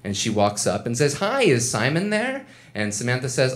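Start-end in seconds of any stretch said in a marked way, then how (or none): 1.2-2.5 high, sweet put-on girl voice; chirpy and innocent, pitched up; sweet girlfriend voice. Girlfriend dropping by work, high and chirpy.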